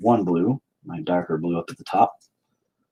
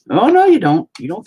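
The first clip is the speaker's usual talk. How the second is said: loud high yelp fading to a mutter; sudden alarm; 11 semitones higher, 7 dB louder than usual. Real, not acted. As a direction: shout at the falling drip, then grumble. Paint drip about to fall.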